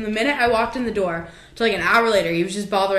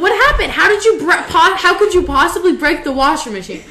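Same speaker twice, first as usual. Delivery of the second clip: loud, high, stumbling disbelief; retold incredulous questioning; 8 semitones higher, 7 dB louder than usual. Sister retelling her questioning of tearful brother, loud disbelief. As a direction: act out baffled, exasperated grilling.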